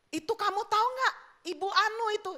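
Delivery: suara tinggi ala tukang gosip; pancing rasa penasaran seperti penggosip. Acted. Penggosip membuka omong kosong. (gaya emak-emak bergosip, nada naik)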